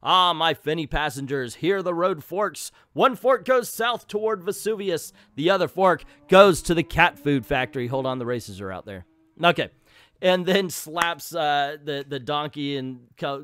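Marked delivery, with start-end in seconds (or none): 0.0-7.9 loud, high, showy announcer voice; a hammed-up announcement; grand announcement. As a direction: proclaim it like a ringmaster to the fish. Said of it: "announces it".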